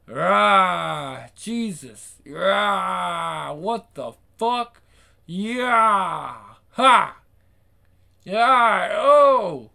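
loud, high yells and grunts of a beating; fight cries. Acted. Men brawl and one is beaten. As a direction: yell through the blows.

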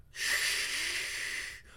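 unvoiced hissing shh; whispered hush. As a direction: hush them sharply. Hushing sound.

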